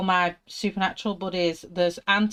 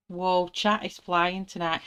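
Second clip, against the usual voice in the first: ordinary talk, nothing marked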